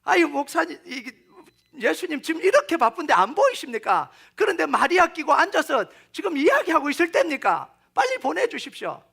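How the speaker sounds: high, loud imitated scolding; scolding Martha. Busy Martha telling Jesus off for sitting with Mary, in acted indignation. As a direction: protest indignantly as the overworked woman. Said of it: "이 여인은 이 마르다밖에 없는".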